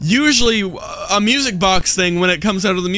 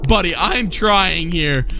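normal talking voice

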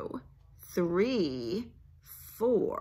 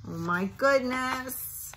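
usual speaking voice

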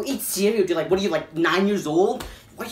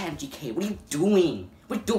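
normal talking voice

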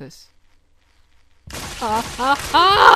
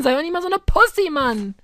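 usual speaking voice

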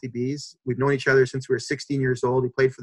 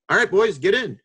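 put-on higher, louder call to the boys; truck driver calling out; 7 semitones higher, 4 dB louder than usual. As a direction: wave them in like cargo. Truck driver calls the players.

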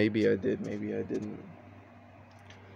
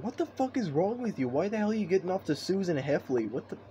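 1 outraged disbelief; 7 semitones higher than usual. Retold or imitated. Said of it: "Now I'm just like".

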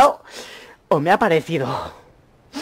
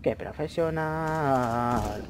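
very slow, stretched out; drawn-out sarcasm; 3 semitones lower, 7 dB quieter, much slower than usual. Real, not acted. Mocking his own shots, very slow drawn-out sarcasm. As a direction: drag the words out mockingly.

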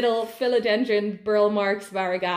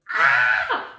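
loud, breathy shriek of joy; excited scream; 10 semitones lower, 6 dB louder than usual. Real, not acted. Overjoyed by the second plant, excited scream. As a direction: let out a burst of delight.

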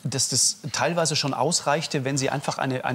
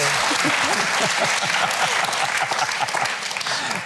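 a burst of breathy laughter at the joke; real laugh; 5 semitones higher than usual. Real, not acted. Host laughs at the guest's teasing remark, breathy laughter. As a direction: break into a genuine laugh.

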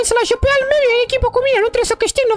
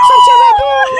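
much higher and louder gloating yell; triumphant shout; 12 semitones higher, 9 dB louder, much slower than usual. Real, not acted. Girl gloating at her brother after a win, triumphant shout. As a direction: shout it like you just won the round.

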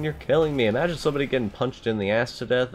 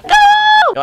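panicked shouting; 11 dB louder, much slower than usual. Real, not acted. Panicked at game.

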